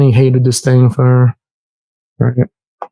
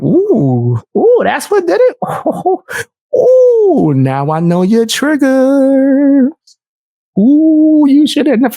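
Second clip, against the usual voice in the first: high, taunting singsong ooh, slow on the triggers; mocking ooh; 10 semitones higher, slower than usual. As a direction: tease her like you found her weak spot. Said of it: "I'm like".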